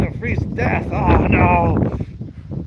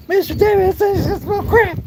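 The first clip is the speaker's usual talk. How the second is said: much higher, hammed-up kid voice; mock tattling kid. Kid telling neighbors about Mister Davis, mock high voice. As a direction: squeak it out like a gossiping child.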